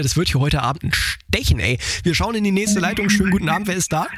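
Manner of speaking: ordinary talk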